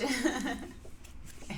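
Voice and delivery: amused chuckle after a confession; real, easy giggle. Real, not acted. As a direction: laugh at yourself. Laughs at her own confession.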